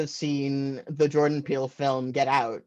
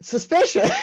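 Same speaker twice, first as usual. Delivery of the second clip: mock suspicious; 7 dB louder than usual. Comedian reacting to family secrets, high mock suspicion. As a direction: squeal it like a conspiracy nut. Comic aside after sister's dodge.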